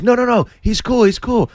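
high-pitched retold calling out; urgent vouching. Man vouching for comic to his friends, urgent shout. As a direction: call off the attackers in a high, urgent voice.

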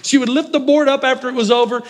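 ordinary talk

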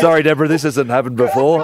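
high, laughing apology. Acted.

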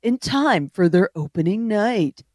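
trailer-announcer voice, slowing at the end; mock narrator voice. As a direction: read it like a dramatic TV voice-over. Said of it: "they'll always be like".

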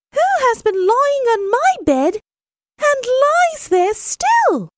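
very high, quick little cub voice; teeny tiny astonished voice. A narrator's reading. Little bear finds someone in his bed, tiny astonished voice. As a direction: squeak it out in astonishment. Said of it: "in a teeny tiny voice of astonishment".

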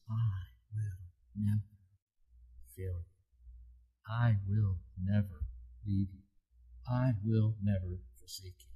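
soft tender promise. Acted. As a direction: let it land gently, like a reassurance. Preacher repeats promise as reality.

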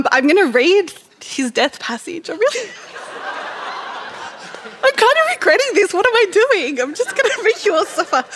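high, giggly apology through real laughter; laughing, pitched well up. Real, not acted. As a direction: giggle helplessly through the words. Laughs, regretting the sad reading.

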